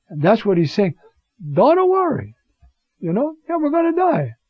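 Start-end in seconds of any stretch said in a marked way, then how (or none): 1.5-4.3 much higher, light voice; high-pitched reassurance. Acted. Preacher voicing Paul telling believers not to worry, high-pitched reassurance. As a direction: brush the worry away in a high voice.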